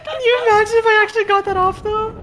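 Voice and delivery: high laughing wail; laughing cry. Real, not acted. Laughing-crying at failed rez.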